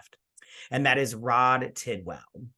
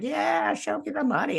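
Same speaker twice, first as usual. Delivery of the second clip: much higher pitched, squealing quote; mock excited quote. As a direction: play the client freaking out with joy. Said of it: "They're like".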